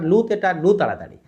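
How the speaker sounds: usual speaking voice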